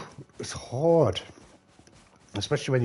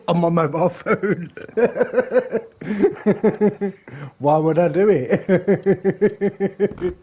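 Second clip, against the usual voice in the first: words bubbling through real laughter; laughing while talking; 6 semitones higher, 6 dB louder, much slower than usual. Real, not acted. Laughing at playing Rust on his phone.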